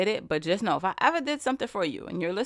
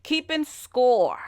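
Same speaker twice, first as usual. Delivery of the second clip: slow emphasis; 4 semitones higher, 3 dB louder, much slower than usual. Shown as a demonstration. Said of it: "my whispering voice".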